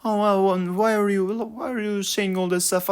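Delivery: high, whiny mock-professor voice; mocking professor impression. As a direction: mimic a complaining professor up high. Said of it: "she was like".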